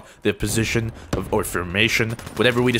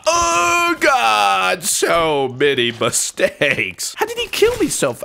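raging gamer; 7 semitones higher, much slower than usual. Real, not acted. Killed, yelling at the game.

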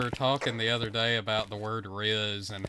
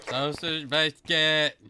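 slow, higher mock kid's cry; screaming kid imitation; 4 semitones higher, 3 dB louder, slower than usual. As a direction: echo the drawn-out child's yell. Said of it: "a little kid at the freezer section screaming".

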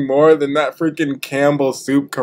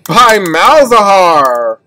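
much higher, louder exasperated rant; frustrated yelling; 8 semitones higher, 11 dB louder, slower than usual. Real, not acted. Gamer ulted by Malzahar again, loud frustrated rant. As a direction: yell it out in disbelief, half laughing. Raging at repeated deaths.

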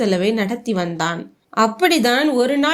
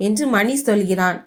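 ordinary delivery, nothing marked

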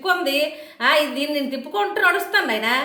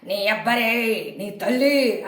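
imitating the Aparichitudu movie scene; movie villain quote; 6 semitones lower, 3 dB quieter, much slower than usual. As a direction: lower and very slow menacing line like the Aparichitudu film. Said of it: "Aparichitudu సినిమాలాగా".

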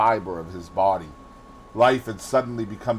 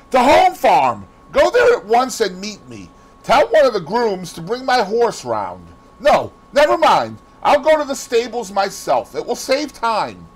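loud, high, urgent commands; frantic orders; 12 semitones higher, 7 dB louder than usual. A narrator's reading. Dorian sends the gamekeeper to the farm, urgently commanding. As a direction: fire off orders at high pitch.

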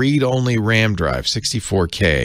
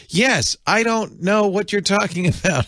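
higher, mock voice-assistant chirp; imitating Siri; 10 semitones higher than usual. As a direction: playfully mimic a chatty phone assistant. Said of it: "Siri goes".

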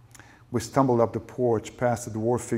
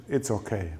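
usual speaking voice

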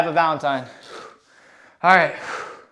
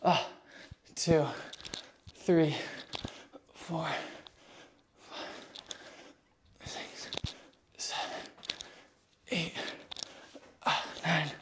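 strained, much softer counting between efforts; straining through reps; 4 semitones lower, 17 dB quieter, slower than usual. Real, not acted. Counting pull-up reps on stream.

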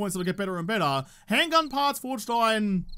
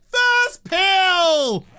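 excited cheer; 9 semitones higher, 8 dB louder than usual. Real, not acted. Cheering a lucky lockpick.

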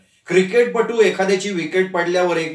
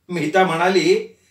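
usual speaking voice

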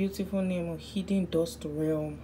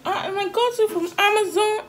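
mock rich kid; 8 dB louder than usual. Mocking rich Amazon buyers.

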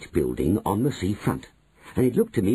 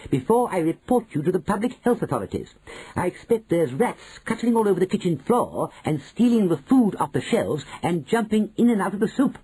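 higher, relentless; relentless grandmother; 9 semitones higher than usual. A narrator's reading. Grandmother to manager, relentless and higher. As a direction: pile it on without letting up. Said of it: "went on relentlessly".

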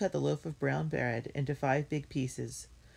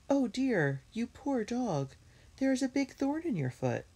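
sympathetic concern; 11 semitones higher than usual. A narrator's reading. Jessie finds a thorn in the dog's foot, sympathetic. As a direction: fuss over a hurt animal in a raised voice. Jessie pities the injured dog.

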